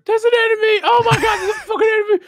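very high, frantic mock shout; hammed-up high-pitched enemy callout; yelling game character. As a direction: squeal the alert like an overexcited soldier. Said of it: "like, your character".

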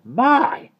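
high, loud exclamation; astonished. A narrator's reading. Miss Tartlett seeing everyone upside down, astonished. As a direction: gasp it out in amazement.

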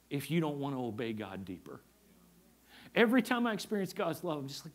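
ordinary talk

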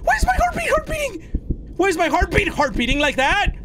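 frantic, very high squeal; high-pitched frantic babble; panicked. Real, not acted. Panics at the heartbeat warning.

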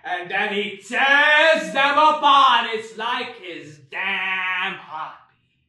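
slow, sing-song ranting swell; preacher's crescendo. Acted. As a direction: rise to a fevered sermon peak. Preaching about God.

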